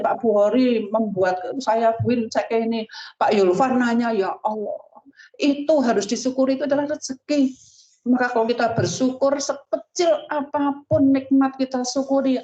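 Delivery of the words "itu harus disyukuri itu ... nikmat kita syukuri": raised, earnest urging; exhorting preaching. Acted. Preacher urging the gathering to give thanks, raised and earnest. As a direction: press the congregation to be grateful.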